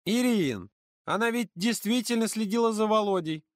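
put-on high girl's voice settling down; calmer girl. A narrator's reading. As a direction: nod along, calmer now. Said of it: "кивнула, успокоившись". (Ксюша успокоившись)

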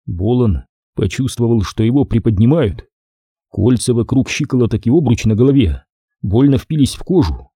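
usual speaking voice

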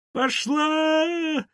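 high-pitched drawn-out shriek; piercing yell held on the last vowel; frantic scream. Acted. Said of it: "закричали истошно".

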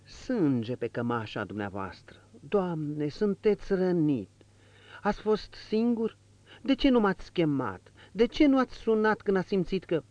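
put-on frightened old valet seeing blood on his master; alarmed exclamation. A narrator's reading. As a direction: gasp it out in alarm. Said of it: "exclamă".

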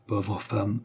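hushed, heavy with sorrow; mild sorrowful sigh of pity; indescribable tone. A narrator's reading. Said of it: "avec un accent impossible à noter".

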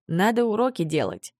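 ordinary delivery

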